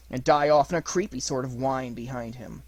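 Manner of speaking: plain reading voice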